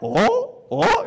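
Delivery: high, excited double oh; sudden delighted realization; surprised oh. Retold or imitated. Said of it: "She goes".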